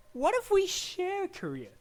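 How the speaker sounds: high, slow, wheedling offer; exaggerated hopeful suggestion; mock tentative proposal. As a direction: float the idea in a comic high voice. Acted proposal of a compromise.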